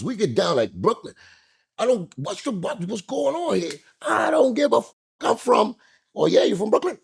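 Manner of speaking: high, sing-song taunting voice; taunting the man at the bar before the punch, high and mocking. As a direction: needle him in a high mocking tone.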